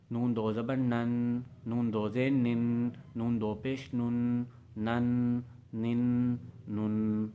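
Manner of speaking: نن read slowly with the sound through the nose; slow nasal tanween recitation; nasal drill. Shown as a demonstration. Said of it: "ناک پہ بھی آواز لے کے جانی ہے".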